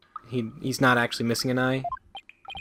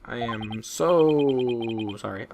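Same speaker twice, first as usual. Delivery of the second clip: very slow, stretched "I am so sorry"; drawn-out apology; much slower than usual. Acted. Streamer apologizing to viewers for the mic noise, slow drawn-out sorry. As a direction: drag out the "so sorry" mockingly. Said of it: "I'm sorry".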